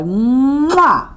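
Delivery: loud smacking kiss; kiss sound. Shown as a demonstration. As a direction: blow a big kiss for the class. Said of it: "Everybody say".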